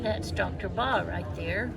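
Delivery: muffled, talking through a mask; through a face mask. Real, not acted. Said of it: "I'm trying to talk through my mask".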